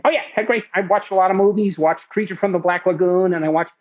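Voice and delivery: high, lively impression; imitating Marty chatting. Director recounting his holiday films, high lively impression.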